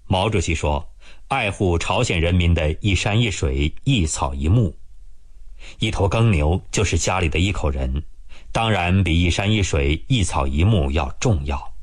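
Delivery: usual speaking voice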